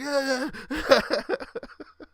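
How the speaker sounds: high, drawn-out mock yell dissolving into laughter; imitated mic yell. Storyteller imitating his shocked friend yelling into the mic, mock yell. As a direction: imitate someone yelling while being electrocuted. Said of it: "you could hear him yelling in the mic".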